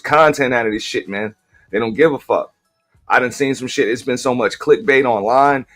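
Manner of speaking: ordinary talk, nothing marked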